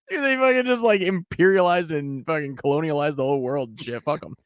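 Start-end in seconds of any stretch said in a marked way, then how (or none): none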